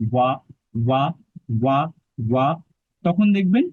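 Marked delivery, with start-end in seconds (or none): 0.1-2.5 ওয়া as a w-like ব, lips not touching; ব without lip contact. Shown as a demonstration. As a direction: say ওয়া with the two lips kept just apart. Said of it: "দুইটা ঠোঁটকে স্পর্শ না করিয়ে বটাকে উচ্চারণ". (দুই ঠোঁট না ছুঁইয়ে ব-কে ওয়া উচ্চারণ)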